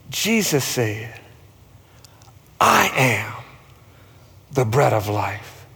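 low, slow scripture reading; hushed-down pitch with long pauses between phrases. Real, not acted. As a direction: let each phrase settle, low and unhurried. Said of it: "I'm gonna read it slow".

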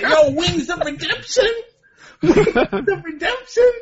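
high announcer-style hype. Acted. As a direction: call it out high and slow. Hyping a gang sign name.

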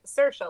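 Saoirse said "SUR-sha", rhyming with inertia. Shown as a demonstration. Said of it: "like inertia".

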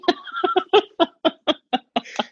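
high-pitched real laugh; delighted high cackle; high laugh burst. Real, not acted. Laughing at own joke.

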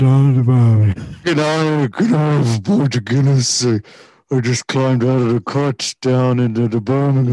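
loud, hammed-up Brummie accent; wildly exaggerated Birmingham voice; mock Birmingham accent. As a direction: belt out a caricature Brummie.

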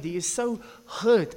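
ordinary talk, nothing marked

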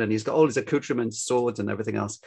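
normal talking voice

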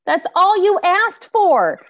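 loud, high, indignant rant; exasperated outburst. Real, not acted. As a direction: cry out in outraged disbelief. Outraged on friend's behalf.

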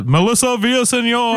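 high Kermit-like voice; Melissa Villaseñor impression. Podcaster mimicking a comedian's Kermit-like voice, high impression. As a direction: put on a high Muppet-style voice. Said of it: "She sounds like Kermit the Frog".

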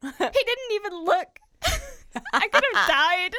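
high, loud, laughing disbelief; loud laughing indignation; laughing outrage. Real, not acted. Laughing at friend ignoring her texts.

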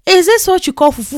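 high, loud shocked cry of disbelief; ah! gasp it out in disbelief; shocked exclamation. Retold or imitated. Shocked tasting fufu.

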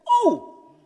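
high, sharp «Oh»; a shocked gasp at the news; surprised gasp. Retold or imitated. Reaction to the friend in hell.

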